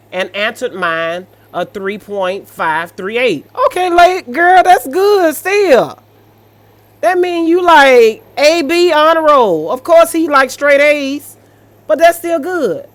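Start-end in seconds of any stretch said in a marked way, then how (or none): 3.7-12.9 high, loud cheering praise; squealing encouragement, much higher and louder; excited hype. Real, not acted. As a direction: hype her up like a proud auntie. Reacting, hyping the poster up.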